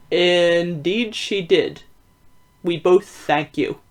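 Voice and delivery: slow, measured guest; slow visitor voice. Acted. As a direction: answer slowly and formally. Visitor replies to the detective.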